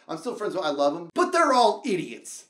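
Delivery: ordinary talk, nothing marked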